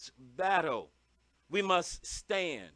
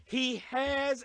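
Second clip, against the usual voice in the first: ordinary talk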